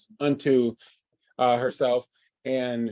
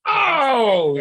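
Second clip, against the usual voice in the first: pained groan; 12 semitones higher, 7 dB louder than usual. Real, not acted. Groan at a wrong guess.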